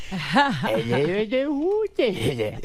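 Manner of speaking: high silly babbling comic voice; Adam Sandler. Acted. Showing the comic's babble phase, high silly impression. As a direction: squeal nonsense, stretching the last words high. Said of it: "then he does the thing".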